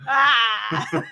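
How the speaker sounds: called-out "ah" with a laugh; a playful, held "ah" for the dentist; open "ah". Shown as a demonstration. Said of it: "Well, you say".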